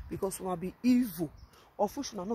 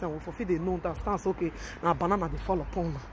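ordinary talk, nothing marked

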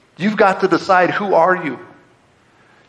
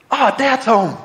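higher, glad cry of greeting; light up at the arrival; happy welcome; 5 semitones higher, slower than usual. Acted. Family greeting dad as he comes home, pleased and higher.

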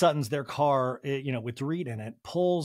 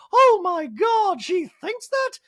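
much higher, louder, slow shocked put-on; mock Dorit gasp; 7 dB louder, slower than usual. Host mimicking Dorit shocked by Kyle's news, high and loud.

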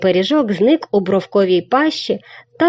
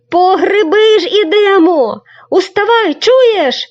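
very high loud shout; 11 semitones higher, 6 dB louder than usual. Acted. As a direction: yell to wake the crane. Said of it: "кричав Марусик". (високий гучний крик)

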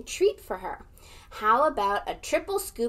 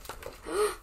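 sharp theatrical gasp; surprised gasp; 8 semitones higher, 6 dB quieter than usual. Acted. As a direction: gasp as if shocked. Surprise at page turn.

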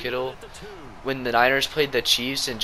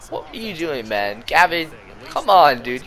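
self-scolding; 5 semitones higher, 6 dB louder than usual. Real, not acted. Scolding himself.

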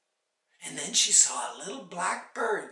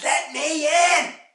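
high, loud, drawn-out demand; angry demand; 10 semitones higher, 11 dB louder, slower than usual. A narrator's reading. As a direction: stretch each word, insisting through the door. Mother demands entry again.

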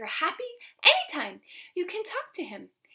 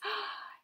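mock gasp; 10 semitones higher, 6 dB quieter than usual. A narrator's reading. Reacts to noise in story.

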